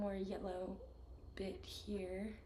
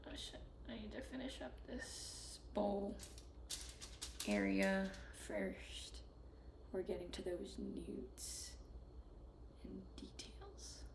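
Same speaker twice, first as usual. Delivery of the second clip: whispered muttering; 3 semitones lower, 7 dB quieter than usual. Real, not acted. Muttering to self while painting.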